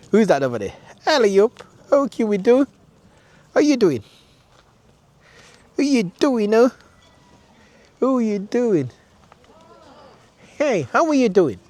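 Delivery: high-pitched greeting to an animal; pet talk. Real, not acted.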